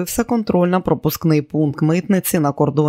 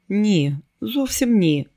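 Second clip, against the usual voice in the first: sniffling; 5 semitones higher, slower than usual. A narrator's reading. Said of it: "шморгнула носом Келі".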